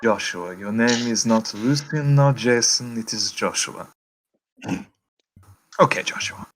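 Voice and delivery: put-on measured German-accented questioning. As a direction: correct him sternly in a German accent. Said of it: "you do the accent of that".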